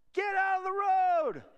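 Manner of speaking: high, loud, drawn-out holler; joking yell. Retold or imitated. Rider hollering from the van at cyclists, joking yell. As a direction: bellow it like heckling from a passing van. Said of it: "We're yelling in the van".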